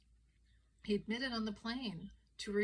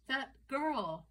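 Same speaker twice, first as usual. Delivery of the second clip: high, insistent mock indignation; acted wifely accusation; accusing wife; 6 semitones higher, 3 dB louder than usual. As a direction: play the wronged wife, pitched up. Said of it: "And the wife goes".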